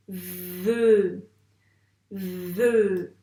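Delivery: "Ve" loud, with a long v into the vowel; loud, slow dictation of "Ve"; dictated syllable. Shown as a demonstration. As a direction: lean on the v of "Ve" and stretch it.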